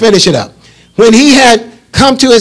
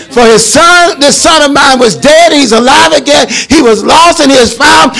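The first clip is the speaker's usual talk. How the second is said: preaching at full force; 4 semitones higher than usual. Acted. Pastor preaching the prodigal's return to the congregation, shouting it out. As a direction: shout it out like the father throwing a feast.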